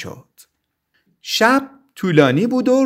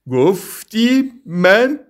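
slow, higher, insulted bear; slow indignant creature voice, pitched up; offended grumble; 7 semitones higher, much slower than usual. A narrator's reading. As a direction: act hurt, drawing the question out slowly. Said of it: "قرید".

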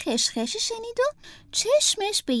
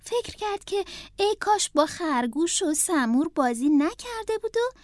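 usual speaking voice

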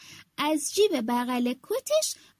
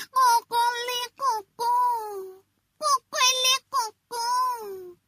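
rooster crowing; 7 semitones higher than usual. Acted. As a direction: crow out like a proud rooster. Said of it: "دوباره قوقلی قوقو کرد".

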